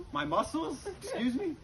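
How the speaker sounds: mock-offended woman; offended lady. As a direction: play the startled, affronted guest. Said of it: "And she goes".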